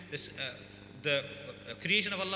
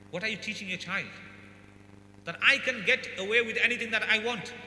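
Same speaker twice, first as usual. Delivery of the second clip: ordinary talk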